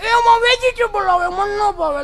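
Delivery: put-on high-pitched, loud fussy child; whiny spoilt child. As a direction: whine like a picky kid. Spoilt child refusing food. (ઊંચા અવાજે જીદ્દી બાળક)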